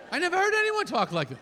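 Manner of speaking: indignant protest. Retold or imitated.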